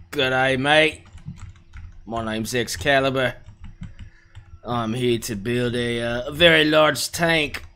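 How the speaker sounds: a lightly hammed-up Australian accent; mock Australian accent. Streamer jokes about Australia, in a mock Australian accent. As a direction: play a cheesy Aussie, half-joking, in Australian. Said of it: "that's the problem with doing accents".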